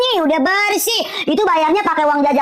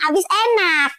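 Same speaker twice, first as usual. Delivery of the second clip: usual speaking voice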